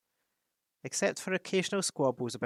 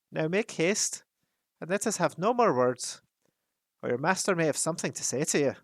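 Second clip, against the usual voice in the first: put-on higher, louder impatient young dwarf; impatient Fili; 5 semitones higher, 6 dB louder than usual. A narrator's reading. Fili pushing the captain, higher and louder. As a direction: hurry them along, exasperated.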